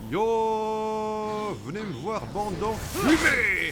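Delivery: hammed-up war shout, slow and high; battle cry. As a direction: bellow a challenge at the enemy. Battle cry charging enemies.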